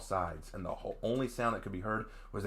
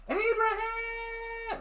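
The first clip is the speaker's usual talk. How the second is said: very high scream stretched out slowly; drawn-out wailing call; long drawn-out scream; much slower than usual. Acted. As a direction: hold the name out as long as you can, desperate. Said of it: "I heard Michelle screaming".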